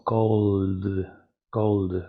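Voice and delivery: cold slowly, long L into a voiced D; D sonora final. Shown as a demonstration. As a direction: let cold buzz through to the final D. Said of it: "cómo se pronunciaría?". (cold con L larga y D vibrante)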